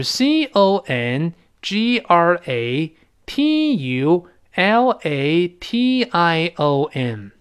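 letter by letter, very slow; drawn-out letters, one at a time; spelling out slowly. Shown as a demonstration. Spells the word for learners.

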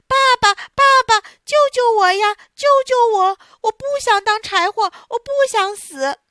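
high, loud, desperate wailing; crying and screaming. Acted. Terrified Pinocchio begging his father to save him, loud and wailing. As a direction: wail for father in terror. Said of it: "大哭大叫起来".

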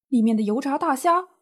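normal talking voice

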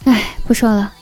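normal talking voice

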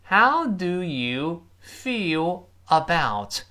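the phrase stretched very slowly, word by word; slow model. Shown as a demonstration. Teacher slowing the phrase right down for the class. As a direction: spell it out slowly for pupils.

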